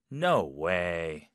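low, sceptical "no way"; disbelieving. Shown as a demonstration. Teacher recapping four no ways, disbelieving. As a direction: let it drop as if you don't buy it. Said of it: "불신".

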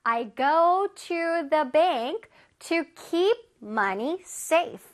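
I read books at the library, every word drawn out; slow example sentence. Shown as a demonstration. Teacher reading an example sentence slowly to kids. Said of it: "그래서".

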